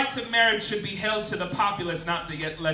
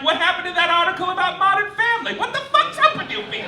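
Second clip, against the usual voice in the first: exasperated outburst; 13 semitones higher, 6 dB louder, faster than usual. Retold or imitated. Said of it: "and I'm like".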